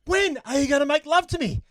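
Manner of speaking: high, loud mock-exasperated woman; exasperated demand. Woman in joke loses patience.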